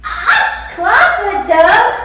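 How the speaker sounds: loud, high, delighted gasp; thrilled squeal at the tub; excited. Real, not acted. Discovering the tub.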